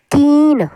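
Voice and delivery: कीन with a long held ee; long vowel. Shown as a demonstration. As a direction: draw कीन right out. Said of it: "देर से हम कीन करके".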